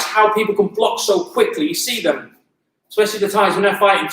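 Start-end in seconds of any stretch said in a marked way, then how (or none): none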